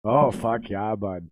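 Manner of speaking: put-on playful thick Minnesota accent; drawn-out Minnesota "oh yeah" imitation. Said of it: "that thick Minnesota accent he's got".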